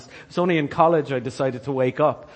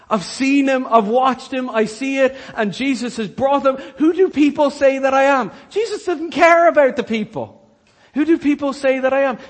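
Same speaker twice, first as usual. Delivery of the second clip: loud, high-pitched sermon peak; impassioned preacher calling out to the church; preaching at full force; 9 semitones higher, 7 dB louder than usual. Acted. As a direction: drive it home at full force.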